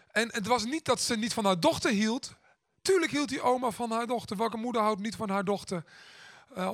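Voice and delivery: ordinary talk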